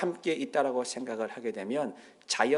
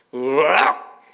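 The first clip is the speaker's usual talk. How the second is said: mock shocked huh; startled gasp; 2 semitones lower, 5 dB louder, much slower than usual. Child reacts as the call ends, startled gasp. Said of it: "했다는 거예요".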